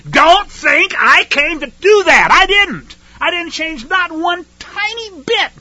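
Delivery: loud, high, emphatic protest; Yeshua. Acted. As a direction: deny it forcefully, voice pitched well up. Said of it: "what Yeshua himself said right off".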